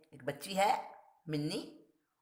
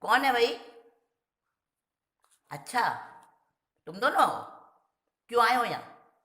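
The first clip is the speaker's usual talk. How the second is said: gruff challenge; 7 semitones higher, 8 dB louder than usual. Acted. The professor confronts the boys.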